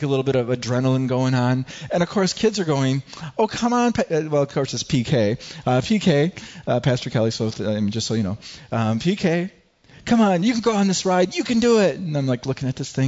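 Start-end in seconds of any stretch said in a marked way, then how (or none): 3.4-3.9 put-on high, eager kid voice; mock kids urging. Kids urging him onto ride. 9.0-12.0 put-on rising as the kids egg him on. Kids urging him onto ride.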